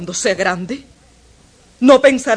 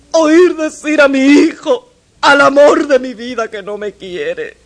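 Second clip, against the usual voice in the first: sobbing mother. Acted. Mother crying to her son who says he doesn't love her. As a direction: wail out the pain of rejection. Mother cries over son's rejection.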